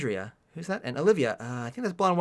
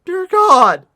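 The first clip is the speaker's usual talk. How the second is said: high, loud, breaking into laughter; exasperated laughing lament; laughing in despair; 10 semitones higher, 9 dB louder, much slower than usual. Real, not acted. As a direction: wail it through helpless laughs, drawing it out. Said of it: "I'm just gonna laugh".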